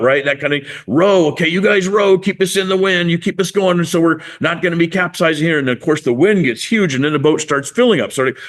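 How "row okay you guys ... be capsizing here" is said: higher-pitched rowing commands; hammed-up skipper calling the stroke; acted urgent orders. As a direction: urge the rowers on through rising wind.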